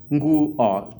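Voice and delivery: "Nguo" as two syllables, ngu-o, with no extra vowel in front; "Nguo": start right on the ng; correct nguo. Shown as a demonstration. Teacher giving the right form against a student's error, firm. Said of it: "two syllables".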